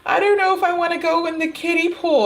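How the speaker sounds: put-on a scared boyfriend whimpering at the kiddie pool; whiny scared kid. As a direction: whine like a child too scared to get in. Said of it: "And he's standing there like".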